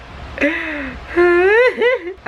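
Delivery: real giddy laugh-cry; squealing laugh-cry. Real, not acted. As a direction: squeal helplessly with joy. Fangirling over fellow YouTubers.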